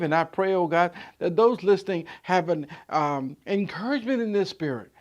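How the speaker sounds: earnest praying voice; closing prayer. Acted. Pastor closing in prayer for his listeners. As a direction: pray over the listeners.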